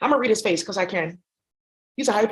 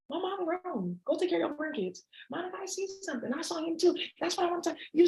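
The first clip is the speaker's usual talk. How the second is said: much higher, much softer mother's voice; mom voice; 7 semitones higher, 9 dB quieter than usual. Retold or imitated. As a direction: coo like a gentle mom. Mom voicing again.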